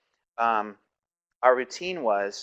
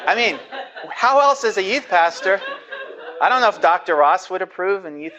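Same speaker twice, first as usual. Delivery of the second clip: higher and louder, laughing through the words; 6 semitones higher, 6 dB louder than usual. Real, not acted. Youth pastor laughing to the class about his staged test. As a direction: chuckle through it at your own crazy plan.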